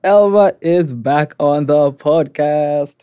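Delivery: slow, loud proud reveal; big announcement. Acted. Grand announcement after drum roll.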